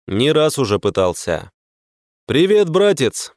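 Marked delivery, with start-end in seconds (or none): 2.3-3.3 mocking, higher cheerful greeting; smirking. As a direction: tease him with a grin. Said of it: "с усмешкой произнёс я".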